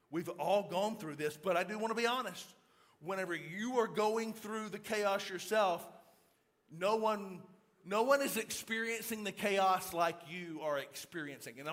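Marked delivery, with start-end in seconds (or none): none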